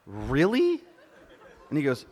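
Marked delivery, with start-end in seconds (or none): 0.1-0.8 loud, rising incredulous question; acted appalled questioning; mock disbelief. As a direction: challenge it with a long upward swoop. Daughter challenges dad's remark.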